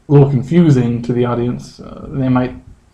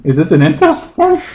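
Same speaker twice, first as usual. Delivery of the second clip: a touch higher, puzzled forum user; lightly mocking forum poster; 6 semitones higher than usual. Imitating a confused forum user who asks his question. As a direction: toss off the question as a clueless poster. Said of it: "people in this incest forum thing question".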